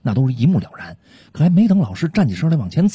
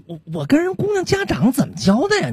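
high fretful voice; 13 semitones higher than usual. Acted. Worried about the girl's parents.

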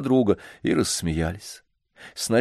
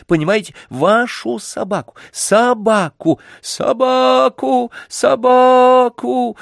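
much higher, building slow shrieks; louder wailing; 6 dB louder, slower than usual. A narrator's reading. Spoiled boy wails for the dog, building louder and slower. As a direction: wail each собаку louder. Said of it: "залился громче прежнего мальчик".

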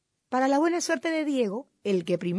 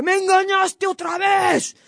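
fooled fox vowing revenge; angry shouting; 4 semitones higher, 8 dB louder than usual. A narrator's reading. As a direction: yell in outrage, stretching the key words. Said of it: "se puso a gritar".

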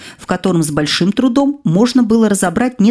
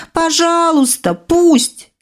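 «Пожалуйста, пусть» slow and much higher, «пусть» hit hard; desperate plea; 10 semitones higher, much slower than usual. A narrator's reading. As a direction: beg the sky, stretching «Пожалуйста». (отчаянная мольба, «пусть» с нажимом)

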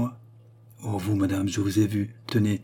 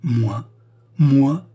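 disbelieving outburst, building louder and higher; indignant protest; 4 semitones higher, 6 dB louder than usual. A narrator's reading. Queen protesting to her accuser, mounting indignant disbelief. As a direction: throw it back, louder the second time. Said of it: "dit la reine en se frappant le sein".

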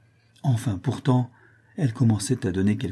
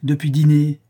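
raised, louder outburst; exclaiming; 6 semitones higher, 6 dB louder than usual. A narrator's reading. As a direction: exclaim it, high and loud. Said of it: "s'écria-t-il".

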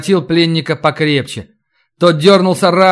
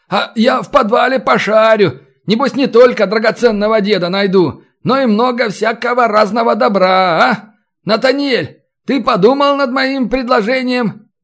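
high, animated bargaining banter; lively raised-pitch storybook rogue; gloating captor; 7 semitones higher than usual. Acted. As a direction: muse aloud about the loot, then press the buyer.

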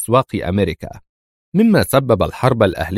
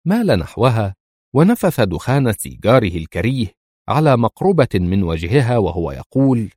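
ordinary delivery

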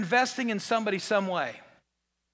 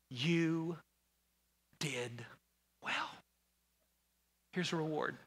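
tender; 6 dB quieter, slower than usual. Acted. God voiced tenderly.

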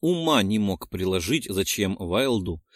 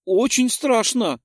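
put-on frightened little girl; 12 semitones higher, 6 dB louder, slower than usual. A narrator's reading. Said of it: "подтвердила та".

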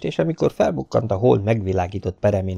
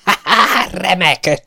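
laughing delight; 11 semitones higher, 6 dB louder, slower than usual. A narrator's reading.

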